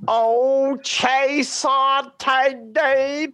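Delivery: singing Italian in a Southern twang, high and slow; mock a Southern student butchering Italian opera. Teacher imitates student singing aria with Southern twang. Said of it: "in his infernal Southern twang".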